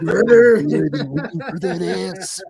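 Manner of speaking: mock pro-wrestler impression, easing off in volume; Macho Man. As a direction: ham it up like a wrestler. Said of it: "I just went Macho Man".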